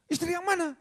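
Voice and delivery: very high-pitched mock husband's question; clueless husband. Husband asked about loving his wife, high-pitched puzzlement. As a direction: toss back a comically high, puzzled question. Husband's punchline answer to lawyer.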